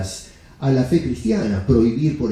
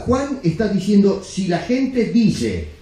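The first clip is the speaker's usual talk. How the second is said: ordinary delivery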